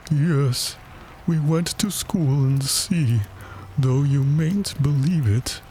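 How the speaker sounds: high, drawn-out mournful storyteller; melancholy turtle voice; doleful Mock Turtle. A narrator's reading. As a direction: tell it with slow, wistful solemnity. Mock Turtle resumes his history.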